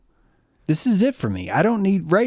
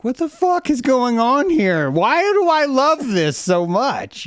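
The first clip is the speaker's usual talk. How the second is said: much higher, louder, slow wonderstruck exclamation; amazed disbelief; 13 semitones higher, 5 dB louder, slower than usual. Retold or imitated. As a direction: gasp it out, overwhelmed by beauty. Awed by a blowing plastic bag.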